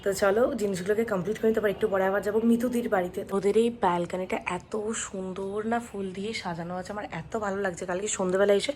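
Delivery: hushed, low-pitched voice; soft, low murmur beside a room of sleepers; speaking softly. Real, not acted. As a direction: keep it down so sleepers aren't woken. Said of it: "আস্তে আস্তে কথা বলছি".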